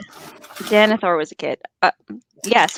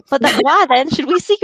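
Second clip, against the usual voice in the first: put-on southern accent; 5 semitones higher, 4 dB louder than usual. Reading Boromir's line in a weak southern accent. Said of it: "give Boromir a southern accent".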